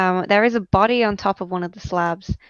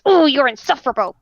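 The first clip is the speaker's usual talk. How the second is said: loud, higher, fed-up complaint; exasperated kobold; 6 semitones higher, 6 dB louder, faster than usual. Acted. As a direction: huff it out at the skull. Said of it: "he grabs the saw and he goes".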